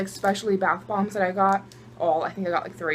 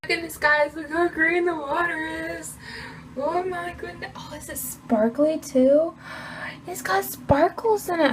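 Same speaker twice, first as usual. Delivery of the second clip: high, slow, delighted gasping; excited wonder; 7 semitones higher, slower than usual. Real, not acted. Vlogger shows viewers the sparkly green water excitedly. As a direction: marvel at the sparkles. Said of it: "I'm so excited for this".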